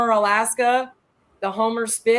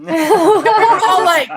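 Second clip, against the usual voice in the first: much higher and louder, slow, through laughter; laughing; 9 semitones higher, 12 dB louder, much slower than usual. Real, not acted. Telling how the otter waved back, laughing hard. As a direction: crack up mid-sentence.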